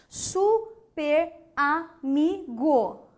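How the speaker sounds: "Super amigo" slowly as su, per, a, mi, go; syllable by syllable. Shown as a demonstration. Teacher showing a wrong separation, slow detached syllables. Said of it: "O que que você achou desta separação?".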